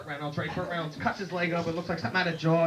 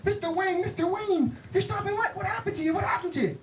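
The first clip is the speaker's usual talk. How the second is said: high, shocked fan voice; alarmed squeal. Retold or imitated. Bartender shocked at soaking wet John Wayne, high squeal. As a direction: rush to the soaked star in alarm.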